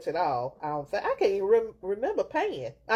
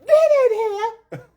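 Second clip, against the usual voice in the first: high, drawn-out mock-mama holler; mama yelling; 10 dB louder, much slower than usual. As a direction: imitate a mother shouting her child in from the yard, slowly. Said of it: "My mama done come out".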